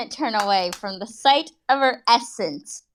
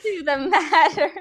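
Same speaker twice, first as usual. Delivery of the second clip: high strained laughing shout; laughing while reading; 10 semitones higher, 6 dB louder than usual. Real, not acted. Reader losing it mid-sentence, laughing shout. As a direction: push the words through hysterics.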